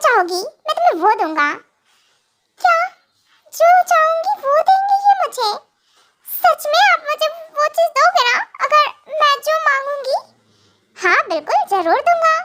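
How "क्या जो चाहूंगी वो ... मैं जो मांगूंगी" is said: higher, eager wife, laughing at the offer; light up at the promise, giggling; excited young wife. Acted. (ऊँची, उत्साहित हँसती पत्नी)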